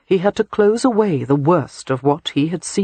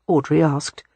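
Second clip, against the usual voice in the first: usual speaking voice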